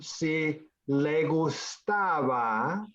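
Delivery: slow, drawn-out phrase for a learner; slow repeat. Shown as a demonstration. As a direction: break it down slowly so the student follows. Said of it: "can you say that again?".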